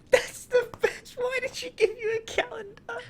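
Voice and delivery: high, squeaking through laughter; helpless laughter. Real, not acted. Friend cracking up at calendar story, helpless laughing speech. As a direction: squeeze the words out while cracking up.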